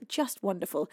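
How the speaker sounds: ordinary talk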